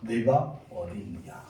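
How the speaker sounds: slow, low and soft question; loving, conversational tone. Retold or imitated. God asking hiding Adam where he is, tender and slow. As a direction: ask gently, as if to someone you love. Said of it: "사랑하는 사람과 대화하듯이".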